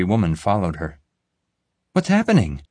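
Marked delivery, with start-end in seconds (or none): 1.9-2.6 high, loud alarmed call across the beach. A narrator's reading. Olivia calling out to the narrator, high and loud. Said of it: "Olivia called out".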